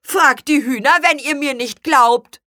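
high, loud, huffy challenge; offended. A narrator's reading. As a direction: snap back indignantly. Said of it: "gab Spargelzahn beleidigt zurück".

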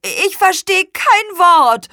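high, slow cry of protest; exasperated high-pitched grumble; loud complaint. A narrator's reading. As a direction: complain loudly, stretching the words. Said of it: "beschwerte sich Spargelzahn".

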